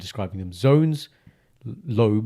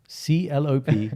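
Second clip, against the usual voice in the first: slow letter-by-letter spelling; sounding out words; 3 semitones higher, slower than usual. Shown as a demonstration. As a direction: spell it slowly again. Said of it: "No, no".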